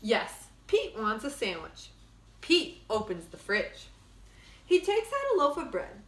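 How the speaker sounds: lively storybook read-aloud; bright picture-book narration; reading to children. Acted. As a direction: read it like story time for kids. Reads picture book to class.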